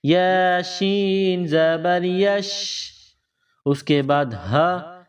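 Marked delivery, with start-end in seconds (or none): 0.0-2.6 یا شین زبر یش drawn out slowly; slow spelling drill. Shown as a demonstration. Teacher re-spelling slowly for pupils. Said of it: "ملائیں گے".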